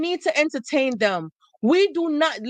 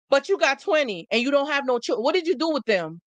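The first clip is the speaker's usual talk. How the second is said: ordinary talk, nothing marked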